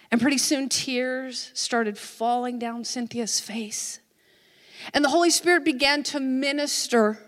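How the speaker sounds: ordinary talk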